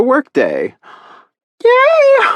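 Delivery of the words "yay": put-on high squealing child's yay; excited cheer. Little niece cheering at her aunt, squealing with joy. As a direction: squeal it like a thrilled little girl.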